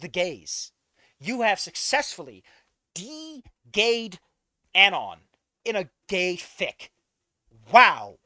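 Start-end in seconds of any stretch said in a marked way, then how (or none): 1.2-6.9 exasperated loud jab. Real, not acted. Exasperated punchline. 7.7-8.2 a big sarcastic loud wow; loud mock-stunned wow. Real, not acted. Sarcastic reaction.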